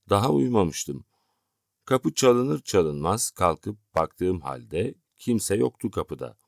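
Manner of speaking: ordinary delivery, nothing marked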